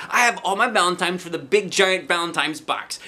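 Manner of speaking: put-on eager child. A narrator's reading.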